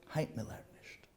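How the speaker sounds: a low, hushed refusal from the Rebbe; quiet stern rebuke. Retold or imitated. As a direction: say it softly but firmly, closing the door.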